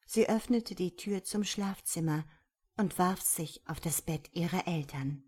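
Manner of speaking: plain reading voice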